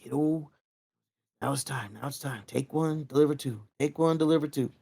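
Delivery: soft, hushed corner-man calming a fighter; calming coach. Retold or imitated. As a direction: settle him down gently, nearly under breath. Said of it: "he'd calm you down".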